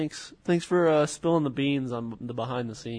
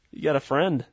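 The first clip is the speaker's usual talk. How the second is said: usual speaking voice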